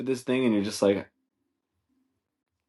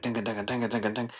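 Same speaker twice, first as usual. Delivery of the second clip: quick rhythmic "ding" patter; light galloping music imitation; imitated chase music; 3 semitones higher, 4 dB quieter than usual. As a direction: mouth a jaunty chase tune. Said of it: "weird chase music playing".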